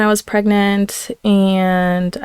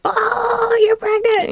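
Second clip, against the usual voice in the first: put-on much higher, knowing little-girl voice; imitating young daughter; slower than usual. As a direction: play the daughter catching on, pitched way up. Daughter voiced connecting the dots.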